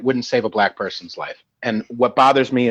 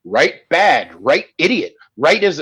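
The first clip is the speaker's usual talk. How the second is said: ordinary talk, nothing marked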